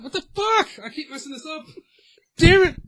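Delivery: loud high burst of frustration; frustrated outburst. Real, not acted. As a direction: snap at yourself for the flub. Frustrated at flubbing intro.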